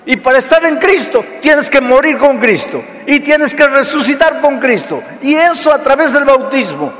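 rising, urgent sermon delivery; preaching. Acted. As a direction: drive it home to the congregation. Preaching over music.

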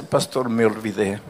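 low and soft, a quiet sad confession; sad admission. Retold or imitated. Young man confessing to the pastor, low soft sad voice. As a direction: let the young man's shame sink in, softly. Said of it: "Y él triste me dice".

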